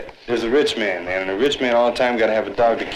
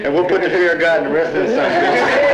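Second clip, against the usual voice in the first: raised, fired-up voice pushing the plan; rally the group, louder and higher; loud and fierce, stirring the others; heated call to violence; 5 semitones higher, 4 dB louder than usual. Real, not acted. Heated call to attack cops.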